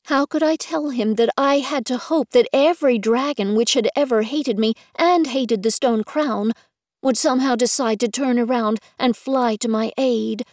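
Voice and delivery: plain reading voice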